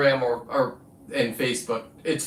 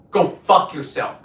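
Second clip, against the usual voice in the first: slower, louder, spitting each word; angry repeat; 3 semitones higher, 3 dB louder than usual. Real, not acted. Telling off online critics again, now slow and furious. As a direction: lean into the repeat with real venom.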